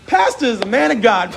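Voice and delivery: usual speaking voice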